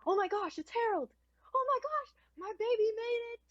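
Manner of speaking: very high, loud squealing delight; gushing parents. Acted. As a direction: squeal with proud parental joy. Parents gush over their son.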